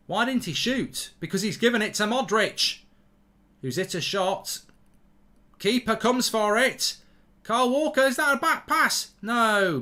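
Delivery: live play-by-play. Real, not acted. Streamer calling a keeper's back pass live, excited play-by-play. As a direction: call the match as it happens, voice rising on the danger. Live match watchalong commentary.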